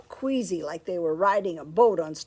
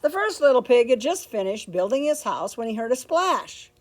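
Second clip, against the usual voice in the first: ordinary delivery, nothing marked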